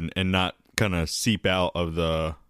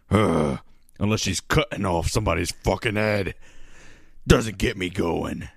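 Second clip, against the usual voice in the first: tough guy voice. Retold or imitated. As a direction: growl it like a hard-ass colonel. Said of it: "He's just like".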